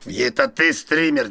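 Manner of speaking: ordinary delivery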